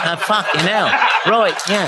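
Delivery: laughing through the words; cracking up mid-sentence. Real, not acted. Laughing at Right Said Fred.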